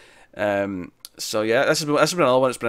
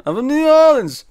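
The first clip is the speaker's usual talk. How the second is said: even higher and louder insisting voice; mock Wiseau accent, higher; 7 dB louder than usual. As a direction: push the claim harder, hammed up. Wiseau repeats his claim.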